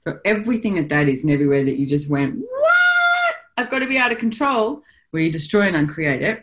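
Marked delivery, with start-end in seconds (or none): 2.4-4.6 mock panic.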